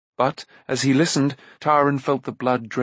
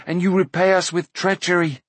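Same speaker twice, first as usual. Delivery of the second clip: higher-pitched, hurt protest; mildly raised, reproachful voice; indignant cry; 7 semitones higher, 3 dB louder than usual. A narrator's reading. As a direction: cry out at a betrayal. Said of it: "Taran cried".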